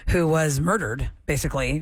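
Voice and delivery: ordinary delivery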